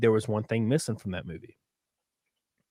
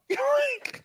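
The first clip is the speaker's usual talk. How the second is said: high laugh; 3 dB quieter than usual. Real, not acted. Cracking up after parody.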